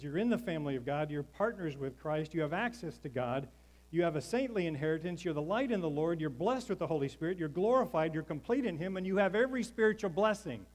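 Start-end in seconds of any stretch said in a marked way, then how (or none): 1.2-10.6 rising louder and higher to a shout; building praise. Acted. Pastor listing the gifts of grace, building to a shout. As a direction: build each blessing into a triumphant cry.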